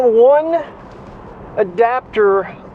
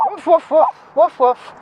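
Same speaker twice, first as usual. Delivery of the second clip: imitated barking; 8 semitones higher than usual. Real, not acted. Barking back at the dogs.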